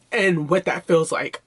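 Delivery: ordinary delivery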